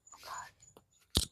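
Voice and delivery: whispered under breath. Real, not acted. Embarrassed aside after brat remark.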